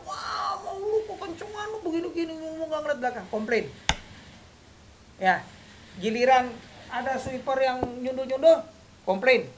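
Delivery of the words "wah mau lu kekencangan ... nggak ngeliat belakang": high, whiny complaint from a rider; mock whine, voice pitched up; mocking a whiny biker. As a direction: mimic a petty biker griping. Biker complaining about road captain.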